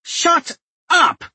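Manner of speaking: sharp high, loud shout. A narrator's reading. Duchess cutting Royce off with a high shout. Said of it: "Jenny shouted".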